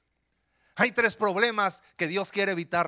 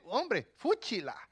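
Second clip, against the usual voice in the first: put-on slow, drawn-out little girl's disgust; mock a little girl saying boys are gross; lightly voiced girl going eww; 3 semitones higher, 6 dB quieter, slower than usual. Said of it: "niñas que dicen".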